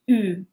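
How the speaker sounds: U fronted with rounded lips; French U. Shown as a demonstration. Learner at the final stage, U pronounced the French way. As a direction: say U as the sound between EE and OO. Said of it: "able to pronounce".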